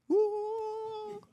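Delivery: very high, long woo; drawn-out falsetto cheer; cheering whoop. Real, not acted. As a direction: let out a high celebratory whoop. Cheering the guest coming up.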